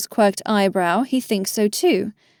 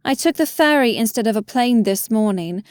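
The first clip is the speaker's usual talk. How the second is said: plain reading voice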